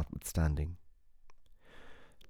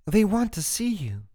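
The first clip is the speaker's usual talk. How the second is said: high, louder sudden blurt; 12 semitones higher, 4 dB louder than usual. A narrator's reading. Jane finally blurts the news to Tyler, high and sudden. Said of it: "Jane simply blurts".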